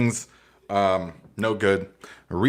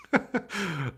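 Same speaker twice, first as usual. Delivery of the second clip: real laughter; 8 semitones higher, 6 dB quieter than usual. Real, not acted. Still laughing at the joke.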